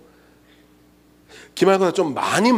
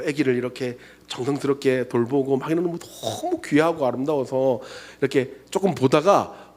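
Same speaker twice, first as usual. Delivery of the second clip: normal talking voice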